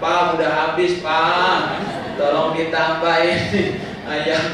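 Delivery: loud mock complaint; calling out. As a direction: call out like a hungry guest, laugh at the end.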